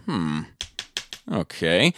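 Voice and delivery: low, quiet, doubtful pondering; puzzled musing. Retold or imitated. Podcaster retelling his reaction to the screen, puzzled musing. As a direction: mull it over softly, unsure.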